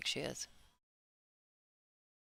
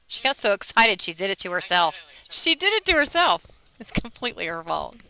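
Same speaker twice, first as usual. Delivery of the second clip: loud, rising excited laughter-talk; 3 semitones higher, 12 dB louder than usual. Real, not acted. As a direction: shout it out in delight. Co-host's coughing fit on air.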